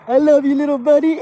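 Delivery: hammed-up buddy affection. Punching a friend in the shoulder, loud mock affection. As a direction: belt it at your little pal.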